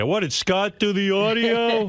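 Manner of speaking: high, slow, drawn-out mock yell; imitated crowd yelling. Host imitates crowd yelling at technician, mock yell. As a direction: heckle like an audience, without real volume. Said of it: "they start yelling at the guy".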